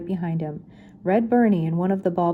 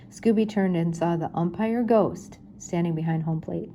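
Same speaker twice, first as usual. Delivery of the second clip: ordinary delivery, nothing marked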